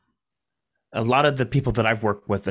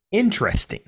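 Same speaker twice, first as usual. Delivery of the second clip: interesting sung out much higher; stress on interesting; 10 semitones higher than usual. Acted. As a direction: lift interesting up high and bright. Punchline word lifted high.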